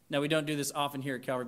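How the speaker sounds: ordinary talk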